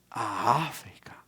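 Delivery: breathy whisper, drawn out; hushed, incredulous echo; stunned disbelief. Retold or imitated. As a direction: whisper it slowly, as if taken aback. Retold stunned reaction to posting.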